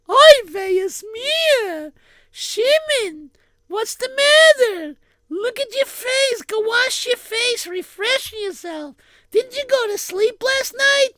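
high-pitched worried mother, scolding gently. Acted. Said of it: "And his mother said".

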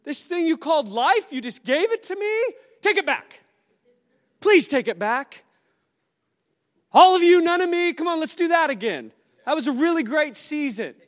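high, loud, hammed-up panic; overwhelmed believer begging God to take it back, loud and high; mock panic. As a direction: shove the gift back in a frantic high voice. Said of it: "you're like".

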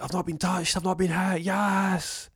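high, excited exclamation; frantic relief. Acted. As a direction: cry out that you survived. Acted relief after being shot at.

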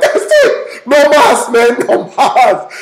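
put-on high, loud, laughing baby voice; a baby throwing in the towel through laughter; fed-up baby. Dunked baby in the baptism, voiced high and laughing.

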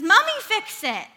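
put-on high, loud toddler plea; acted small-child desperation; desperate little boy. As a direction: imitate a toddler desperately begging mom. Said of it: "out of sheer desperation".

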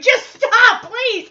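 loud, high-pitched fed-up outburst; imitated exasperated yell. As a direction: cry out like you've had enough. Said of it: "Jackson's had enough".

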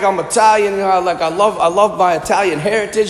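casual mock Jersey Italian accent. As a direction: play a Jersey Italian guy proud of his roots. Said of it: "a Jersey Italian accent for you".

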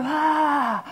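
a loud sudden "ah" of awe; awed cry. Real, not acted. Preacher voices the gasp inside him. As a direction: let out a gasp of wonder.

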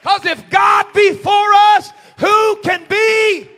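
huge, high shout with long-held words; full-force preaching. Real, not acted. Preacher declaring God is for us, full-force shout. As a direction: thunder it out slowly to the congregation.

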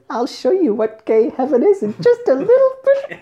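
high, camp mock [name] purr; hammed-up [name] impression; imitating [name]. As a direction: tease like [name] teasing a straight guest. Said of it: "And [name] says".

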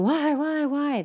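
high, insistent mock whine; resistant questioning. Teacher imitating a student who keeps asking why, resistant. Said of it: "that's just resistance".

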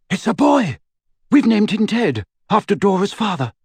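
high, joyful cry of news; cried aloud. A narrator's reading. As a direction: call out the news, overjoyed. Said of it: "then cried aloud".

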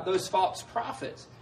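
ordinary talk, nothing marked

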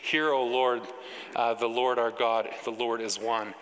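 ordinary talk, nothing marked

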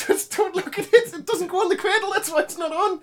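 high, squeaky laughing plea; giggling helplessly through the words; laughing fit. Real, not acted. Host giggling at broken speaker, high laughing plea.

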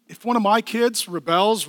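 ordinary talk

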